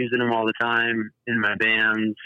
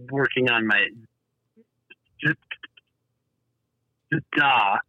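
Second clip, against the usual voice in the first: blocked on a word; stuck on a stutter. Real, not acted. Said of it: "sorry about that".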